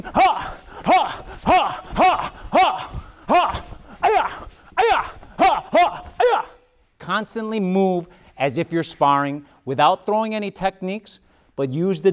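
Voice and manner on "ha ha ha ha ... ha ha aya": rapid high kiai yells; kiai shouts. Shown as a demonstration. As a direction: punch out each yell while bouncing. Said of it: "keep bouncing".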